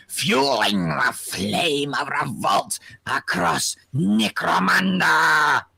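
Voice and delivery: slow, grandiose menace, sinking into a whisper; theatrical villain proclamation. Acted. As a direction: declaim it slowly like a doom prophet. Reads villain's speech in a put-on voice.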